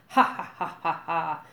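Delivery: a loud, booming belly laugh; hearty laugh. A narrator's reading. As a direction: laugh heartily at his own joke. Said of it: "Gobber laughed heartily".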